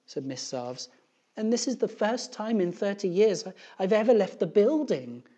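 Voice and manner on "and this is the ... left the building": high, loud complaining teacher; upset schoolteacher, voice raised; aggrieved teacher. A narrator's reading. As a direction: pour out a put-upon teacher's grievance.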